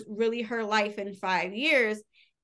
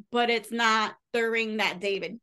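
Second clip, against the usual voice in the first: ordinary delivery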